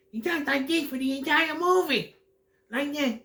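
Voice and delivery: very high squeaky mocking character voice; Brian James voice. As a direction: mimic him way up in a pinched falsetto. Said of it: "Like Brian James".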